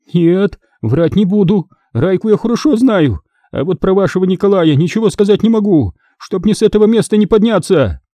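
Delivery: high-pitched, earnest swearing; earnest captive woman. Acted.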